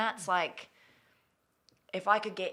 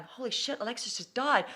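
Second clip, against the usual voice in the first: mock shock; 7 semitones higher than usual. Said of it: "people are reading this going".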